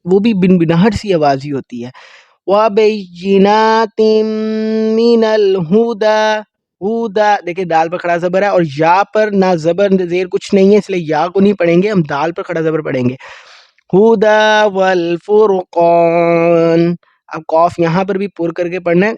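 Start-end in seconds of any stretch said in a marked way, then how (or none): 2.5-7.4 slow melodic Quran chanting; Quran recitation. Acted. 13.9-17.0 slow melodic Quran chanting; Quran recitation. Acted.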